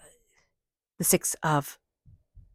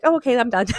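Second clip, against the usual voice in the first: put-on a light, higher kid's voice, louder; little kid; 5 semitones higher, 5 dB louder than usual. Voicing a child done with a tantrum, light and higher. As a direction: play the kid who's over the tantrum. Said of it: "be like that little kid".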